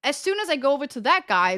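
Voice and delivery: usual speaking voice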